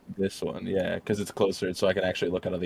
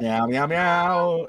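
nervous cat voice; 9 semitones higher, 4 dB louder, much slower than usual. Pretend. Cats in cave joke.

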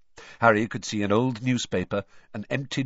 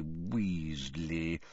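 muttered to himself; 5 semitones lower, 8 dB quieter than usual. Acted. Muttering while checking list.

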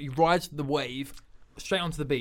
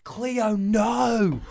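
mock shout; 7 semitones higher, 5 dB louder than usual. Acted ad, character yelling.

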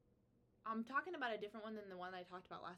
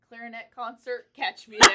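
loud meme voice; 3 semitones higher, 25 dB louder than usual. Retold or imitated. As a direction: belt out the meme line. Reading a funny meme aloud.

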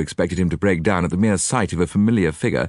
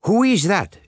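put-on high, raised French-accented demand; Poirot demanding; 12 semitones higher, 4 dB louder than usual. A narrator's reading. Detective challenges the figure's identity, demanding. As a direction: call out the question sharply. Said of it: "demanded Poirot".

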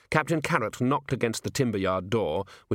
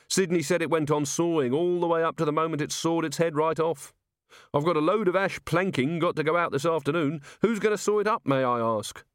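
higher-pitched, put-out tradesman; grumbling timber merchant; 7 semitones higher than usual. A narrator's reading. Merchant grumbles to the Watch about his golem, put out. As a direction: complain like an inconvenienced yard owner.

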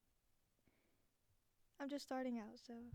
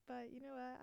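ordinary delivery

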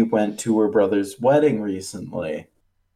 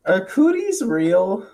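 imitating kid; 9 semitones higher, slower than usual. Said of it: "he just goes".

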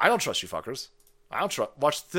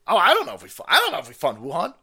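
mocking her dodge; 4 semitones higher, 8 dB louder, faster than usual. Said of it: "she just told you she doesn't know".

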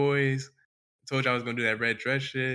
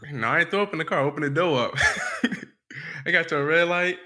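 laughing through the words; chuckling as he talks; 4 semitones higher, 4 dB louder than usual. Real, not acted. Laughing while telling.

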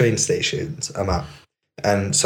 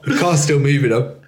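normal talking voice

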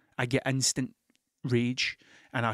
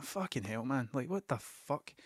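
muttered, much softer disbelief; quiet disbelief; 10 dB quieter than usual. Retold or imitated. Reading Big Jim on his hand, stunned and muttering. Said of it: "I'm like".

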